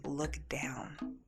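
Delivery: hushed, near-whispered retold voice; soft whispered instruction; mysterious voice. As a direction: say it very softly, like a voice from nowhere. A mysterious voice speaks to her.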